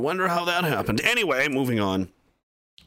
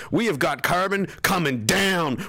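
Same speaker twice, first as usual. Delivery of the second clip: ordinary talk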